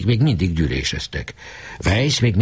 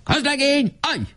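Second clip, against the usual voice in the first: very high barked military halt; rasping command. A narrator's reading. Weiss ordering the private to halt, high barked command. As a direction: bark the order like an officer. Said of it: "Recsegő hangon kiáltott utána".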